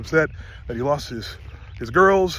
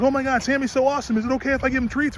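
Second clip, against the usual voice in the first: high-pitched imitation of an excited gusher; gushing owner impression; 8 semitones higher, faster than usual. Mares' owner meeting Sammy's people, gushing. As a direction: gush it up high, imitating her delight.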